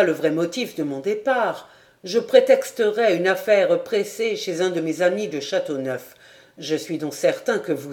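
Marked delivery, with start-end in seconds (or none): none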